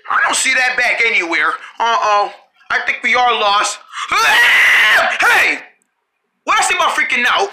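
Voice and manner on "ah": high shrill scream of panic; panicked scream. Acted. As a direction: scream in fright. Kids panic at being lost.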